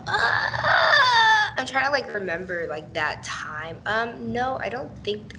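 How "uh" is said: long, loud, high-pitched hesitation noise; drawn-out thinking "uh". Real, not acted. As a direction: stall with a high, held "uh". Stalling while trying to remember.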